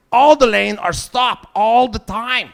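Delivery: loud, slow indignant rant; exasperated stand-up shout. Acted. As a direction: hammer it out in disbelief.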